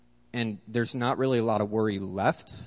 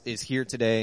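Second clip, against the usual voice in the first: usual speaking voice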